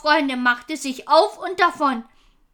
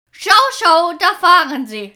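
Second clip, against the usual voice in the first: loud, higher, laughing with relief; hearty laughing shout; 3 semitones higher, 7 dB louder than usual. Acted. Chief laughing as the enemy turns away, loud. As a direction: crow it out through a big laugh. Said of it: "lachte Halvor aus vollem Halse".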